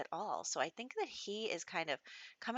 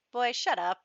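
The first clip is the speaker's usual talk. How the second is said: higher, louder mock brush-off of "Boy, shut up"; dismissive snap; 6 semitones higher, 7 dB louder than usual. Noelle snapping at Pelham to stop, a mock louder brush-off. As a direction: brush him off, a little louder. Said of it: "Noelle's just kinda like".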